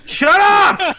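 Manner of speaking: loud, high-pitched yell back at teasing; indignant shout. Real, not acted. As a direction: shout it at the people laughing at you. Teased about the bad accent.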